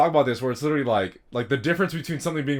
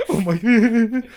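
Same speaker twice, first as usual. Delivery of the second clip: laughing; 9 semitones higher, 8 dB louder, much slower than usual. Real, not acted. Cracking up at the joke.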